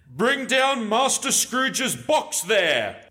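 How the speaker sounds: slow, booming schoolmaster's cry; terrible voice. A narrator's reading. Schoolmaster orders the box down, in a terrible drawn-out cry. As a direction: call it out slowly as a fearsome master. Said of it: "A terrible voice in the hall cried".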